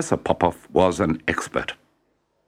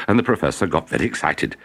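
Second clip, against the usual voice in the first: plain reading voice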